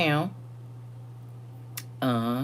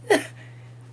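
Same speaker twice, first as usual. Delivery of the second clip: breathy high-pitched laugh; a squeaky emotional laugh; tearful high giggle. Real, not acted. Laughing while near tears.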